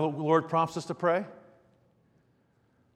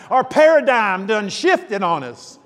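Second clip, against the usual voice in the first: broad, slow southern drawl, louder and higher; southern accent; 4 semitones higher, 6 dB louder, slower than usual. Acted. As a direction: ham up a folksy southern accent. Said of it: "with a southern accent".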